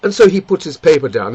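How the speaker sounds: ordinary talk, nothing marked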